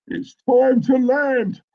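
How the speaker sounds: high, slow, drawn-out announcement; sing-out the landing slogan in jest; stretched, hammed-up pilot cry; mock pilot call. Continuing the comic pilot slogan bit.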